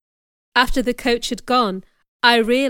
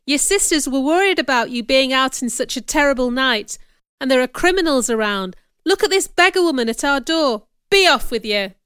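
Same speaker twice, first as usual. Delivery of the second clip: put-on loud, sharp old housekeeper voice; scolding old servant; 3 semitones higher, 6 dB louder than usual. A narrator's reading. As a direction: chase the beggar off the doorstep.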